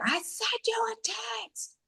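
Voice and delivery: hissed whisper, high and breathless; whispering the complaint through clenched frustration. Retold or imitated. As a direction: spit it out under your breath. Retold fight with husband.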